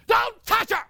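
high, loud commanding shout; acted wife's sharp high yell; urgent shouted warning. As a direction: shout to stop someone instantly. Wife stopping him urgently.

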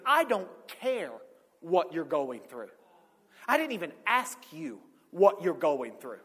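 stern retort. Retold or imitated. Retelling mentor's blunt retort.

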